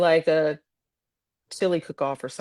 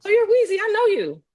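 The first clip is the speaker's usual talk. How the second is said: much higher, eager fan voice; mock star-struck parent; 4 dB louder than usual. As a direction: ham up a pushy parent. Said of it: "I'd have 'em come up".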